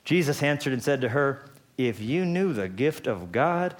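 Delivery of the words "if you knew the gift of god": slow, lofty pronouncement; grand opening line. Acted. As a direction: announce it like a grand gift. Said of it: "Is that how you start a conversation?".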